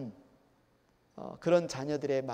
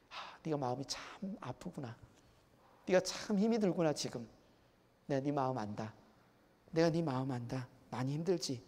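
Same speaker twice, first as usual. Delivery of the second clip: hushed empathy with a sigh in it; comforting parent; 6 dB quieter than usual. Acted. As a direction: gently soothe a hurting child. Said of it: "이 말 한마디일까요".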